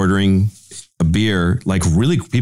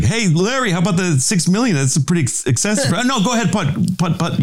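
mock heckler; 9 semitones higher than usual. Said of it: "The guy's like".

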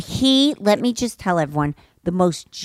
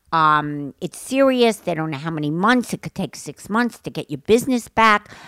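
ordinary talk

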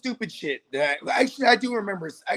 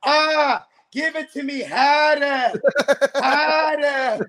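high, slow moaning "harder"; hammed-up high moan, harder; mock Boston lovemaking; 7 semitones higher, 4 dB louder, much slower than usual. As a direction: ham up a Boston woman in bed. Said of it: "Boston couple making love".

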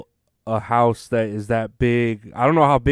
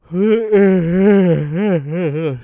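laughing; 7 semitones higher, 6 dB louder than usual. Real, not acted. Laughs to open chat.

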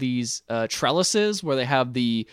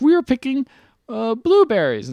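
put-on kids' fib; 11 semitones higher than usual. Kids lying to the captain.

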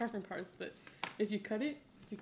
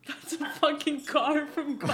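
loud, slow, higher insistence; emphatic amazement; 4 semitones higher, 7 dB louder, slower than usual. Real, not acted. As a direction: insist loudly, drawing out each word. Insisting on a surprise find.

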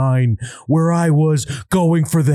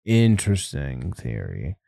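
low, slow deadpan; deadpan sarcastic; 8 semitones lower, 5 dB quieter, slower than usual. Real, not acted. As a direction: say it flat and unimpressed. Dry sarcastic reply.

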